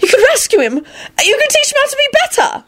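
put-on high, desperate girl voice; frantic pleading. A narrator's reading.

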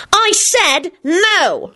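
high, slow shrieked refusal; shrieking. Acted. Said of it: "shrieked Solange".

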